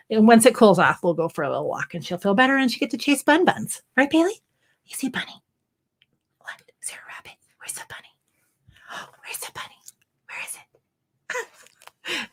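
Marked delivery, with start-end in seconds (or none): 4.0-4.4 sing-song dog talk. Real, not acted. Talking to the dog. 4.9-12.3 breathy whisper about the bunny; excited whisper. Real, not acted. Teasing the dog, whispering.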